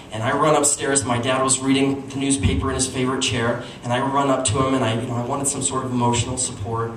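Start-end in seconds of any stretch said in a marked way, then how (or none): none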